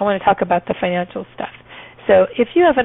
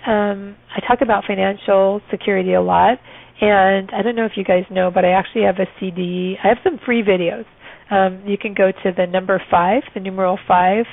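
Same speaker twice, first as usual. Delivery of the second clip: ordinary talk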